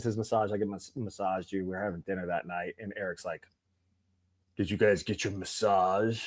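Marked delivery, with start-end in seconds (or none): 4.6-6.2 slow, drawn-out buddy voice; teasing buddy asking about massages, slow mock drawl; buddy's voice. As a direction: mimic how the buddy asks, slowly. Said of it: "That's how we talk".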